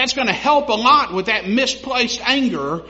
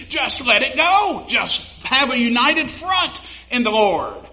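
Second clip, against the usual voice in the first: raised, urgent preacher exhortation; fervent preaching; 4 semitones higher, 4 dB louder than usual. Acted. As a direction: press the congregation to let grudges go.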